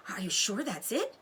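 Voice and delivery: ordinary delivery, nothing marked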